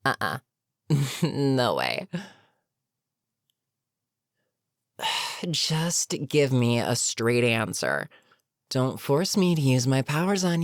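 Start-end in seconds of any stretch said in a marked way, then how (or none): 5.0-5.5 soft, impatient exhale; exasperated sigh. Acted. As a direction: sigh at a stalling opponent. Villain tires of hero's evasions.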